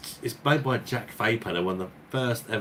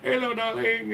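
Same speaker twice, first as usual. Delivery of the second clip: squeaky high hello; high sing-song. Retold or imitated. Both greeting each other in a high voice. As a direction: lift it into falsetto. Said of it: "we was both like".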